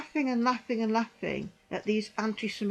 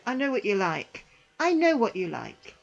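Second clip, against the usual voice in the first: normal talking voice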